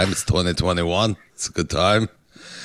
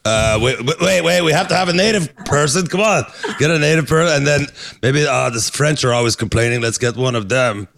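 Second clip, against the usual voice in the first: mock casting director; 7 semitones higher, 4 dB louder than usual. Mocking panel selection, loud.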